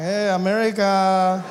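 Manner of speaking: slow, loud echo of a babe; mimicked greeting. Comedian greets surfer babe back, slow mimicking call. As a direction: stretch it out, half-heartedly mimicking a gushing call.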